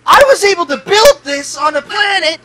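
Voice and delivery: high, loud boastful shout; Tony Stark. Retold or imitated. Tony boasting to the heroes about his escape from Titan, loud and high. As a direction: brag it at full volume like a swaggering genius. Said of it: "Tony Stark was stuck in a cave".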